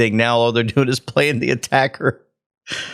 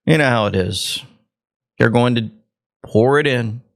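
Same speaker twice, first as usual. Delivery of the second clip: ordinary talk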